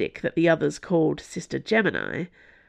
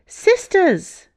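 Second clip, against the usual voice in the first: high, loud alarm call; yelling; 6 dB louder than usual. A narrator's reading. Nun warning her sisters across the courtyard, yelling. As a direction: yell out to the whole convent. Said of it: "yelled another nun".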